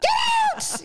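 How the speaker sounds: sudden exasperated outburst; mock road-rage yell. Acting out her road rage.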